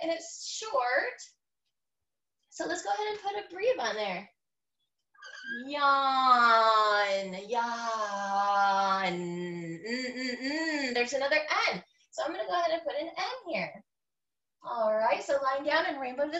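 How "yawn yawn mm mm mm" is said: "Yawn" stretched very long and low; drawn-out yawn. Shown as a demonstration. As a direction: hold the vowel of "yawn" as if yawning it. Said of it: "I was yawning".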